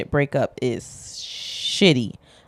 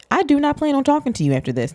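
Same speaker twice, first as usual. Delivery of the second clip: high, louder mock rant; acted indignant venting; 11 semitones higher, 5 dB louder, faster than usual. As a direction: act out an outraged phone vent, pitched way up. Acted vent call to a friend.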